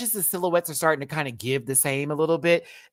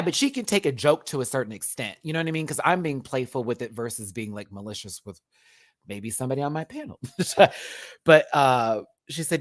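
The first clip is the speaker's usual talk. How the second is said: normal talking voice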